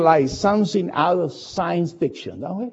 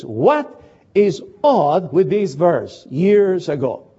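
ordinary talk, nothing marked

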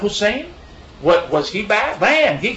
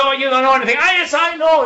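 mock argument; 8 semitones higher, 4 dB louder, faster than usual. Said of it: "we have a back and forth".

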